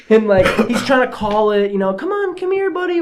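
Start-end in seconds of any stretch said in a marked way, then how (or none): 1.9-3.0 coaxing a dog. Retold or imitated. Derek calling the frightened dog over, high coaxing voice. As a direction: coax the scared dog closer.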